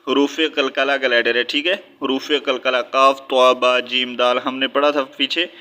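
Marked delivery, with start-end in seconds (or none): none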